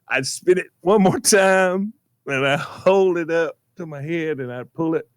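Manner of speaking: ordinary talk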